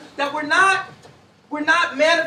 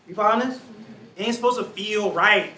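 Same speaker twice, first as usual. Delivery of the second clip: usual speaking voice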